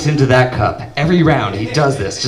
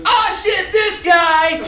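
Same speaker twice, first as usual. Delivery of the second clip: high, far-off crowd whoop; party cheer; much slower than usual. Retold or imitated. Frat guys greeting the dad arriving, high whooping cheer. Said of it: "everyone in the room goes".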